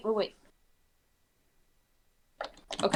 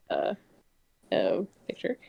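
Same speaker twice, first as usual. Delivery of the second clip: whispering; 13 semitones lower, 6 dB quieter than usual. Real, not acted. Quick aside while reading.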